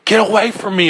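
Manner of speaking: high, loud panicked yell; terrified yell. A narrator's reading. Man alone at the mirror yelling in terror. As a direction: scream it at the eyes in terror. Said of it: "I yelled".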